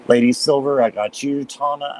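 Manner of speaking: ordinary talk, nothing marked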